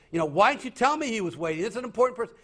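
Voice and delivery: high, sharp rebuke of the receptionist; scolding boss. Retold or imitated. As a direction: chew out the receptionist. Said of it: "and lays into them".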